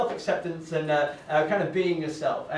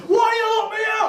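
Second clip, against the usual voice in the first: put-on high, loud drunken yell; drunk ex shouting; 6 dB louder than usual. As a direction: shriek it like a drunk accusing. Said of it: "and she goes".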